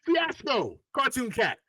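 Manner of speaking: high, bright mock hype call; playful greeting. To the writer at a show: high, teasing hype greeting. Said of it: "I'll see you again and be like".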